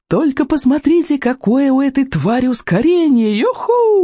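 high, exhilarated yell over roaring wind. A narrator's reading. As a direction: cry out high in delight at the speed. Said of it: "орала Камикадзе сквозь рёв ветра". (восторженный крик сквозь рёв ветра)

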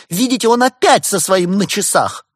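high, loud indignant complaint. A narrator's reading. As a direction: point at him outraged. Актриса возмущённо жалуется. (актриса возмущённо жалуется, высоко и громко)

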